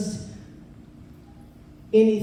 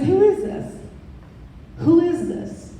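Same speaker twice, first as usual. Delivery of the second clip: insistent demand; 8 semitones higher, 4 dB louder than usual. Retold or imitated. Repeating demand into phone.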